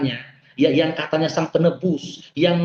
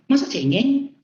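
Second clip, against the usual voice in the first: pitched-up doubting question, mocking; scoffing disbelief; 8 semitones higher, slower than usual. Recalling his first reaction to the book, scoffing in disbelief. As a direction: scoff at the idea that he is weak. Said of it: "Nggak cocok ini, pikir saya".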